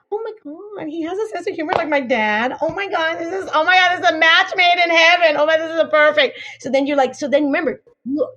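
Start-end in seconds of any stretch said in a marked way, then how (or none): 0.1-6.4 gushing. Retold or imitated.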